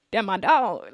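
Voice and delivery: ordinary talk, nothing marked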